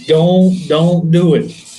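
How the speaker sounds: high, slow teasing insistence; slow down and sell it, half-joking; playful insistence. Real, not acted. Repeating the pitch, louder.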